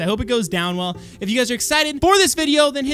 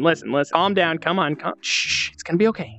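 soothing; 6 semitones lower, 4 dB quieter than usual. Acted. Soothing a nervous friend.

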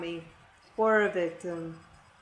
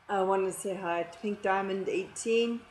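normal talking voice

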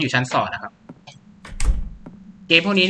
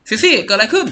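worried call; 8 semitones higher, 5 dB louder than usual. Acted. Calling to Sissy in danger.

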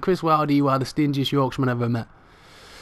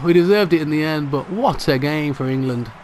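normal talking voice

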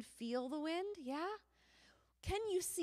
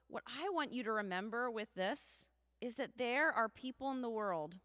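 ordinary talk

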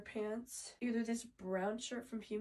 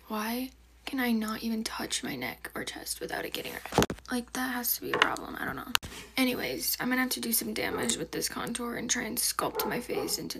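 whispering; 11 dB louder than usual. Real, not acted. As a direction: keep it all in a whisper. Whispers the whole video.

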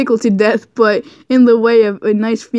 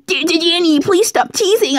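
high begging plea, stammered; mock begging, pitched up; 7 semitones higher than usual. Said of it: "I begged".

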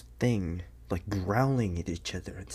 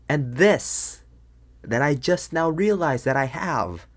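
louder, higher and alarmed; a raised alarmed voice showing off the fangs; 5 semitones higher, 7 dB louder than usual. Acted. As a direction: blurt it out in alarm. Discovering fangs in panic.

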